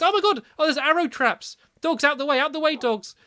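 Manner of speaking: high, loud burst of alarm. Pretend. Gamer finds arrow traps and warns dogs, alarmed.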